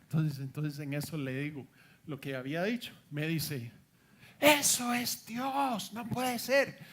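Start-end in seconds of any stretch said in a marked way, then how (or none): 4.4-6.8 voz aguda, fuerte y alargada de asombro; asombro imitado. Retold or imitated. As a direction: imita a la hermana sobrecogida, exagerando. La hermana conmovida, voz imitada. (exclamación aguda de incredulidad, actuada)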